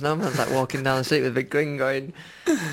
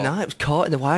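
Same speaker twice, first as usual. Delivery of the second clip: ordinary talk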